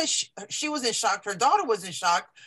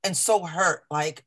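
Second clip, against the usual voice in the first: usual speaking voice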